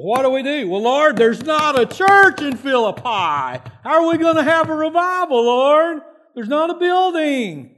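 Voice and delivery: loud, high-pitched hammed-up wailing; mock panic. Team hits a detour with no church, mock-panicked complaint. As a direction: whine to God in exaggerated dismay.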